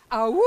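loud howling awoo; wolf howl. Shown as a demonstration. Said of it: "a nice good howl from you".

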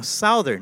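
"Southern" as sow-thern, like "South"; Filipino way of saying it. Retold or imitated. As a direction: insist on "Southern" with the "south" vowel. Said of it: "I go".

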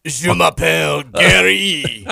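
loud, drawn-out hammed-up French accent; mock French accent. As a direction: badly fake French, stretched out slowly. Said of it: "use the French accent".

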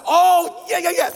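high, loud burst of realisation; sudden recognition. Retold or imitated. Moviegoer finally placing the actor's voice, excited recognition. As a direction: blurt it out as it clicks.

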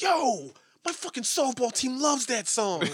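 high, loud, drawn-out excitement; excited recognition. Retold or imitated. Friend realizing he knows the song, excited. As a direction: imitate a friend thrilled by the discovery.

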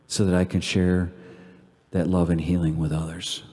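low, soft, reverent prayer; quiet devotional prayer with long pauses. Real, not acted. Leads closing prayer.

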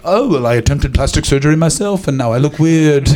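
mock British accent, hammed up; Gordon Ramsay. Celebrity chef explaining his face, hammed-up British accent. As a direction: exaggerate the British accent into caricature. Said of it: "That's what your accent sounds like to me".

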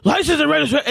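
aggressive officer. Retold or imitated. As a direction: imitate an officer rapping out a demand. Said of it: "she's so aggressive with me".

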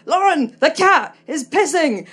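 mock yell of a mum on crutches, slow; mum shouting. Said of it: "my mum would shout from downstairs".

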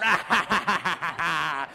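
mock-sinister elf laugh; evil cackle. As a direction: laugh like a cartoon baddie twirling his mustache. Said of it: "twisting its little mustache and going".

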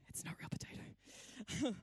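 slow secret whisper to the crowd; a conspiratorial whisper; whispered aside. Real, not acted. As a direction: let them in on a secret, hushed. Confessing aside to congregation.

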